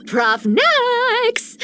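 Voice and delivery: high, sing-out nickname call; cheeky high-pitched hail; playful calling out. Acted. Calls professor by playful nickname.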